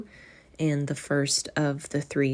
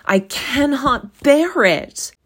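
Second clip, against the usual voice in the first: higher, louder, slow anguished plea; desperate cry; 9 semitones higher, 5 dB louder, slower than usual. A narrator's reading. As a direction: break out in despair. Scrooge desperate before the ghost.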